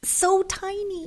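put-on high, slow little-girl voice; tiny child voice. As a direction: squeak it out like a small girl. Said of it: "Her voice is, like".

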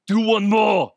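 loud, high gym-coach shout; Arnold coaching. Retold or imitated. Arnold cheering a nervous guy through a final rep, shouting. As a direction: bark encouragement at the lifter. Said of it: "Arnold's like".

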